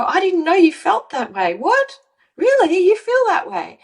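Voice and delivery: high, astonished mock reply; surprised partner. Coach voicing a partner surprised at the confession, astonished. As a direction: react with disbelief, pitch up.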